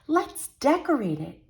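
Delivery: a lightly voiced girl, high and eager; a little girl eagerly begging to decorate the tree; excited cry. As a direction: cry out, bursting to start. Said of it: "Beth cried".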